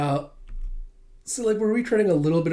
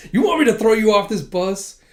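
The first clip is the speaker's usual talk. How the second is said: much louder, higher furious threat; 8 semitones higher, 8 dB louder than usual. Acted. Damien threatening to throw Anya off the bus, furious yell. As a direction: blow up at her. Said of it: "Damien just gets furious".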